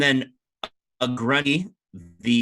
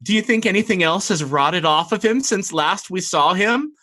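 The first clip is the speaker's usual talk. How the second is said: higher, scornful mermaid voice; sneering mermaid sister; 7 semitones higher, 4 dB louder than usual. Acted. Vanamira mocks the party to her sister, higher and sneering. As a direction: sneer at the dead thing they brought. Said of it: "She says".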